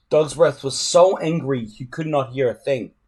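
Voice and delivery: plain reading voice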